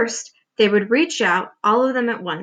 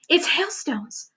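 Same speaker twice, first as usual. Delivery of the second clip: excited whisper; 6 semitones higher, 4 dB quieter, slower than usual. A narrator's reading. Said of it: "whispered James excitedly".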